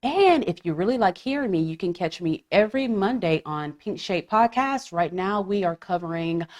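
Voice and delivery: soft, low-key promo read; podcast plug. Real, not acted. Host reminds listeners to subscribe, low-key plug. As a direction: run through the plugs quietly and evenly.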